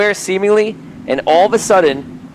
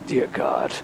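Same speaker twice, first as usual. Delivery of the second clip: much lower, softer dread from the front; 10 dB quieter than usual. Retold or imitated. Heard from the front of the boat, deep and quiet. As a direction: drop deep and quiet like a distant voice. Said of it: "very low, you hear".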